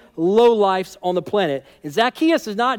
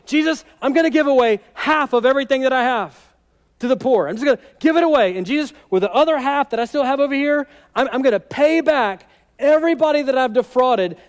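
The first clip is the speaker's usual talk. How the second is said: higher, louder, rushing promise; blurting out a joyful pledge; eager vow; 6 semitones higher, 3 dB louder than usual. Acted. Zacchaeus eagerly promising Jesus to repay everyone, excited. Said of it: "he immediately goes".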